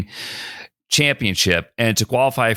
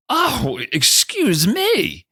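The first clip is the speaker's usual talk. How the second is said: high, slow, mock affronted; sarcastic, exaggerated politeness; taken aback; 11 semitones higher, much slower than usual. Startled golfer backing off from a cocky rival, mock affronted. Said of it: "so put back by his".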